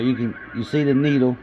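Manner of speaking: ordinary delivery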